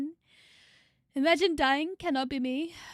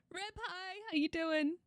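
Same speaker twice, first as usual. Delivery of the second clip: ordinary delivery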